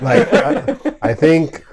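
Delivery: loud, high, laughing start; real laughing. Real, not acted. Player reacting to the gentle slapping joke, laughing. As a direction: try to get words out over laughter.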